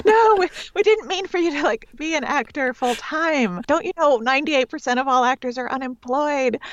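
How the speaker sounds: high, alarmed parent voice; panicked mom and dad protesting; freaked-out parents. Retold or imitated.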